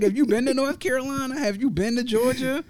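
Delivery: high-pitched, incredulous challenge; exasperated protest. Retold or imitated. Retold protest to New York cousins.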